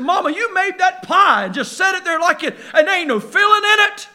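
a hint of a sulking kid; whiny boy. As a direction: whine it like a hurt little boy. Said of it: "He said".

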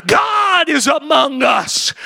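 high, ringing preacher's cry; preaching at full force. Acted. Pastor lifting the congregation, preaching at full force. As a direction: lift it up like a revival preacher.

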